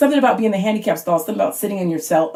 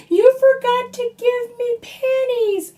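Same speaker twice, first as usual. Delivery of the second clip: put-on very high, slow little girl's voice; whiny daughter; slower than usual. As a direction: whine it like a five-year-old shifting blame. Daughter blames mom.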